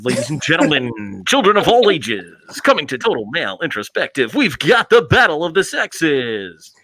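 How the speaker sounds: big slow ringmaster announcement; announcer voice. Shown as a demonstration. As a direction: boom it out like a ring announcer. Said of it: "announcer voice I can do".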